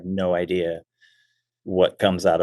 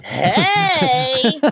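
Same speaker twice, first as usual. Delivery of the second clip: very high, hammed-up kids' TV cheer; Nickelodeon show voice; 3 dB louder than usual. Comic joining the Mikey bit, hammed-up show cheer. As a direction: squeal it like a mock kids' show catchphrase. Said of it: "it sounds like a Nickelodeon show".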